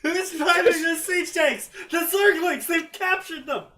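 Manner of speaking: high, loud shouts of disbelief; mock alarm. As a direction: sound the alarm in comic panic, very high. Acting out shocked reaction to captured tanks.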